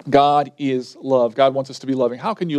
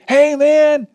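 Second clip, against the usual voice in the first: loud, high-pitched mock holler; excited greeting; 11 semitones higher, 5 dB louder than usual. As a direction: call out to a friend, bursting with news. Acted excited neighbor calling out.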